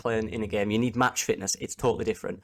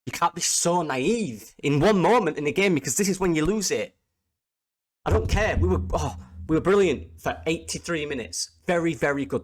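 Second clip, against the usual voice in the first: fuming rant; 5 semitones higher than usual. Real, not acted. Fan ranting to viewers about the defending, fuming. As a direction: let the frustration burst out at the team. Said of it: "I'm absolutely fuming".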